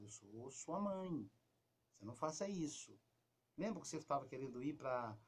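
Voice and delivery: quiet, low, calm talk to a daughter; soft calm mother. Shown as a demonstration. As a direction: speak low and gentle to her. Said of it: "Você pode falar, falar com ela baixinho". (mãe falando baixinho com a filha, calma)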